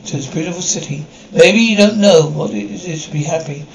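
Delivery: ordinary talk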